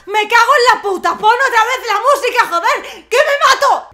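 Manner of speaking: screamed, high comic meltdown; hysterical mock rage. Vlogger begging for music back, hysterical mock fury. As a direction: explode into frantic yelling.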